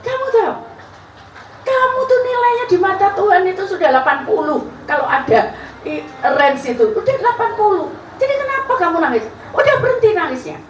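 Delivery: very high, fast pastor voice; pastor's voice. Retold or imitated. The pastor tells her to stop crying, high and quick. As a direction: rattle off the pastor's words up high. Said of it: "quoting the pastor".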